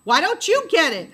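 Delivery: loud, high defiant scream; a girl screaming back at her brother. A narrator's reading. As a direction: scream it right back at him. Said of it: "I screamed".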